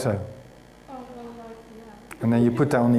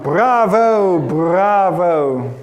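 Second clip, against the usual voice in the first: loud, high, drawn-out bravos; noisy energetic cheer; 5 semitones higher, 6 dB louder, much slower than usual. Retold or imitated. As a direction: let all the energy out in a shout. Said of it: "had to release some of that energy".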